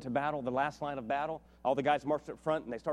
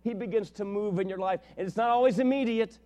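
raised, louder pulpit delivery; impassioned preaching; 9 semitones higher, 6 dB louder than usual. Real, not acted. Preacher urging the congregation to pursue God, impassioned. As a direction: preach it high and forceful.

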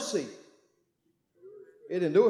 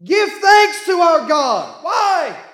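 slow, loud, higher exhortation; preaching shout; 7 semitones higher, 6 dB louder, much slower than usual. Real, not acted. Preacher urging the church, slow and loud. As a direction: stretch each word and call it out.

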